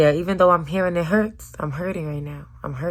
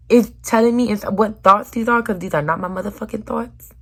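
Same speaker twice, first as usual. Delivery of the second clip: higher, louder exasperation; angry outburst; loud frustrated vent; 6 semitones higher, 5 dB louder than usual. Real, not acted.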